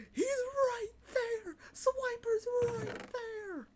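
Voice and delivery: high, slow, crying shout at the screen; kid screaming at the TV. Retold or imitated. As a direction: wail it like a frustrated child. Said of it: "Dora screaming at the screen".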